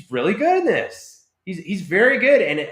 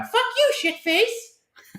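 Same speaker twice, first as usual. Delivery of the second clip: high squeaky alien voice; little alien; slower than usual. Retold or imitated. Retelling the video game alien's insult, high squeaky creature voice. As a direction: mimic a tiny foul-mouthed creature, pitched way up. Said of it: "that little alien that shows up and goes".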